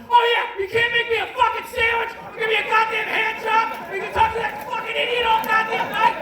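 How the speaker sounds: high, loud ranting shout through the doors; mock angry yelling. Imagined husband yelling through doors at wife, furious shout. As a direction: scream it from behind the doors. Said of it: "being like".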